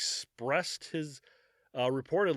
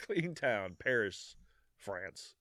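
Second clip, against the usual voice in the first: laughing repeat; 3 semitones lower, 5 dB quieter, slower than usual. Real, not acted. Repeats the name while laughing.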